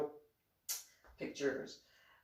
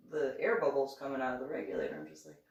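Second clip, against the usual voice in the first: normal talking voice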